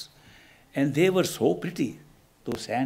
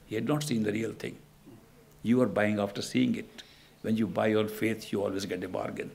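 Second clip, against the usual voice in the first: a hint of a soft, low old sage; holy man quietly explaining faith to the greedy boy, gently; gentle holy man; 4 semitones lower, 5 dB quieter than usual. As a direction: answer the boy calmly and kindly, a little lower.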